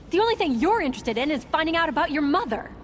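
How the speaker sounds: fast, high-pitched outburst; angry accusation. Acted. Woman accusing son of only wanting news of his mother, angry. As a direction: rush it out as a heated accusation. Acted accusation of using her.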